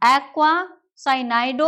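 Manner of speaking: drawn-out, syllable by syllable; slow dictation. Shown as a demonstration. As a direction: spell out the name slowly by ear. Reads out compound name for students. (धीमे, खींचकर बोलना)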